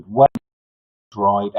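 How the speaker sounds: ordinary talk, nothing marked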